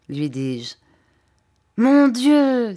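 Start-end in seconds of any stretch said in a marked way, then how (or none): 1.8-2.7 higher, louder cry of astonishment; sudden exclamation. A narrator's reading. Steerforth suddenly recognises his old schoolmate, exclaiming. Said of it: "s'écria-t-il tout à coup".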